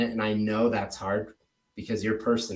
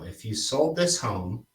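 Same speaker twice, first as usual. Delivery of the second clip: slow, measured "If you sold this home"; really slow; 3 dB quieter than usual. Shown as a demonstration. Coach shows trainee how to go slow, unhurried pace. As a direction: take your time through each word. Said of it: "I want you to go really slow".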